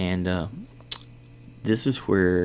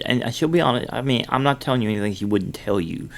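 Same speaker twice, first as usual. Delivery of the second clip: ordinary talk, nothing marked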